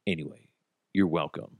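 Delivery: ordinary talk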